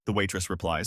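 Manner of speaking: plain reading voice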